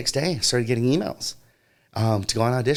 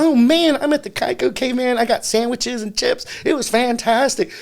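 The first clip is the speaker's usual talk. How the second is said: very high, excited raving; gushing; 13 semitones higher, 4 dB louder than usual. Retold or imitated. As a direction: rave like a starstruck fan, pitched way up. Acts out raving about the shoot.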